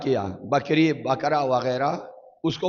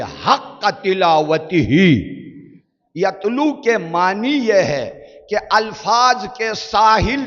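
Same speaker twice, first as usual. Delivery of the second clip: high, loud, slow sermon; preacher paints the sea of the Quran, slow and loud; impassioned preaching; 6 semitones higher, 5 dB louder, slower than usual. Acted. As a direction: pour the image out with rising passion. Preaching at full force.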